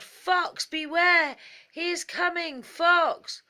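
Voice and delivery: false alarm yelled from under a bush. Acted. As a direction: shout it so the whole picnic hears. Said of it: "he yelled".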